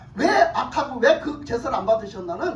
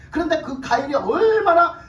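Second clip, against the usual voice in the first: ordinary talk, nothing marked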